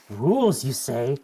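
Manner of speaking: playfully perked-up interest; mock excited curiosity; excited mock intrigue. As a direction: act keenly intrigued, drawing out the first word. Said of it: "I got so excited. I'm like".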